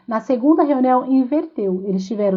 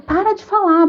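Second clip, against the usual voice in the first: put-on sharp, higher, louder snap at a child; impatient snap; 8 semitones higher, 4 dB louder than usual. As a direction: bark it out, patience gone. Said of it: "Eu perdi a paciência com ele". (mãe impaciente, ríspida)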